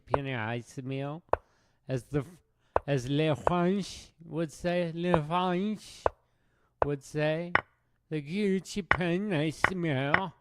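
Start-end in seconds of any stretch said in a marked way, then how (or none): 2.8-7.5 hammed-up French accent, higher and slow; mock French accent. Chess streamer mocking how French people talk in a French accent. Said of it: "That's how French people talk". 8.1-10.3 "Giochi Pianissimo" said higher, in a hammed-up French accent; mock French accent. Naming the chess opening in a mock French accent. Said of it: "That's how French people talk".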